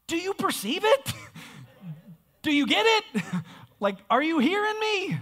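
high, laughing preacher's plea, lines cut by laughs; imitating a pleading prophet. Isaiah acted pleading with crowd.

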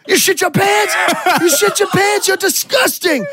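high, shrieked accusation; losing it. Retold or imitated. Retelling how Joe reacted to the soiled pants, mock hysterical shriek. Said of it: "Just, like, loses it".

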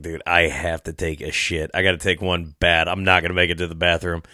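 hushed desperate plea to a friend; urgent confiding. Retold or imitated. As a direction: mutter the emergency low and soft. Desperate need for the toilet.

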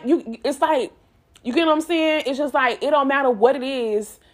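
ordinary talk, nothing marked

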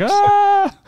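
high, loud imitated scream; drawn-out mock shriek; panicked scream. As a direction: scream like someone fleeing in fright. Priest bolts out the front door.

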